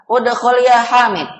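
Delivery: loud, high order; commanding. Shown as a demonstration. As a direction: order the pupil sharply. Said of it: "menyuruh atau memerintah".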